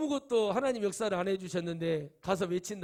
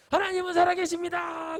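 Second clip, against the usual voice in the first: high, loud cry of praise; a man off the mine yells praise to God, high and loud; joyful shout; 8 semitones higher, 7 dB louder than usual. Retold or imitated. Character shouts in relief.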